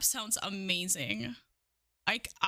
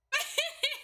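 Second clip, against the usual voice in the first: high laugh; 14 semitones higher than usual. Real, not acted. Laughing at squat count.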